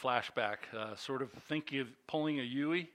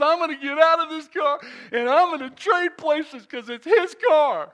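high, loud, laughing declaration; excited loud; 11 semitones higher, 12 dB louder than usual. Retold or imitated. Teen telling the cop he'll swap seats, loud and giddy. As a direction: announce it with giddy relief.

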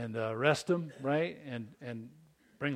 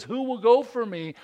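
raised, much louder voice of God; God calling out; 9 semitones higher, 9 dB louder than usual. Acted. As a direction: call out a question high and strong. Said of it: "So when God says".

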